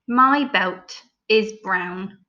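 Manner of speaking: slow, each word drawn out; slow dictation. Shown as a demonstration. Teacher dictating the sentence slowly. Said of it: "say it slowly".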